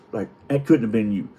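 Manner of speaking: normal talking voice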